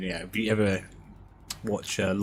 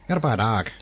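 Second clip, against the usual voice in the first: Irish Pikey line; 3 semitones higher, 6 dB louder than usual. Retold or imitated. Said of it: "Plays the Irish Pikey".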